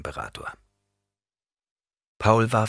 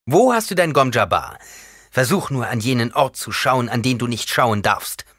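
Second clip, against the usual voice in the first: loud, high-pitched taunting; defiant challenge; 5 semitones higher, 7 dB louder than usual. A narrator's reading. Paul confronting the old woman, defiant and loud. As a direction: challenge her with raised voice.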